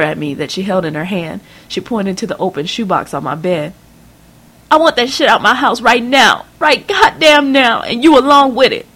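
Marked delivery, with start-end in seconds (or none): 4.7-8.9 high, loud enraged mother's voice; a scandalised mother tearing into her daughter; shrill rising tirade, higher and louder; furious mother. Acted.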